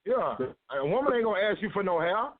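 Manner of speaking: ordinary delivery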